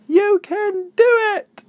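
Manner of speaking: very high, loud, slow call; cheering. Acted. Lexi calling encouragement down to Izzy, high and slow. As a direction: stretch it out like a cheer. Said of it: "she said".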